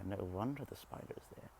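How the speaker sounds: normal talking voice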